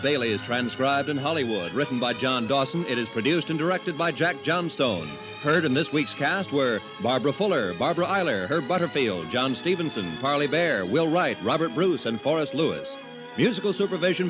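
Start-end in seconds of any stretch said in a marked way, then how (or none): none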